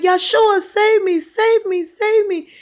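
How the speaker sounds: very high, loud frantic pleading; imitated panicked screams to be saved; screaming for help. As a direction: scream it over and over in desperation. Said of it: "he was screaming this loud over the phone".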